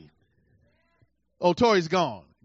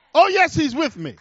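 exultant affirmation; 8 semitones higher, 7 dB louder than usual. Acted. Preacher answering call-and-response.

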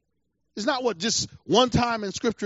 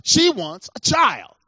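ordinary delivery

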